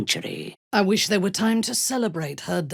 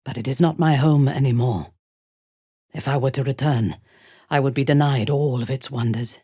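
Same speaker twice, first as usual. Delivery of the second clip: normal talking voice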